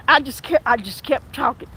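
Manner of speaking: ordinary talk, nothing marked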